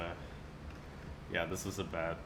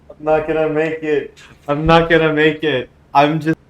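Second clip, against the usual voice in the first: high, loud laughing cry; breaking up with laughter; laughing hard; 8 semitones higher, 16 dB louder than usual. Real, not acted. Laughing fit at cringe thread.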